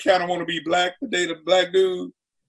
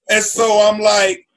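ordinary delivery

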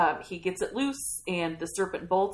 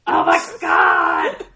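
drawn-out, much louder, lower cry of disbelief; amazed shout; 14 dB louder, much slower than usual. Real, not acted. Host reacting to the serpent's grand line, shouting slowly. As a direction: let it out slow and big.

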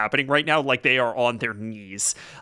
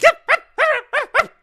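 very high, loud happy yelp; excited dog yelp; 8 dB louder than usual. Acted. Freed dog yelping happily, very high and excited. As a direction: yip it like a thrilled little dog. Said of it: "very excited and very happy".

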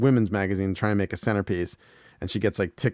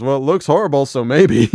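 louder mock Hank; Hank's verdict; 5 semitones higher, 8 dB louder than usual. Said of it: "and Hank goes".

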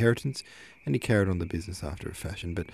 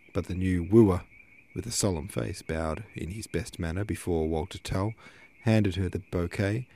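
plain reading voice

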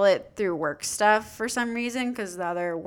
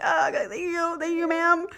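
high-pitched, awkwardly polite; awkward thanks; 9 semitones higher than usual. Retold or imitated. Tourist thanking fussing grandmas, high awkward politeness. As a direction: reenact flustered thanks in a high voice.

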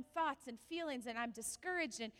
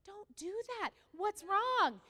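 alarmed retort; 6 semitones higher, 5 dB louder, slower than usual. Retold or imitated.

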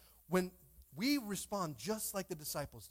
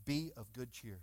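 low, soft reassurance; calm encouragement; 11 semitones lower, 9 dB quieter than usual. A narrator's reading. Jesus encouraging disciples in the storm, calm reassurance. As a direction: settle them with a low, steady voice. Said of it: "Jesus comes out and encourages them".

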